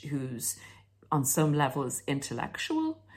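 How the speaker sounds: normal talking voice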